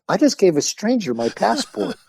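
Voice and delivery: high-pitched, comic realization; mock dismay. Teller thinks over handing off his passport, mock dismay. Retold dawning alarm after handover.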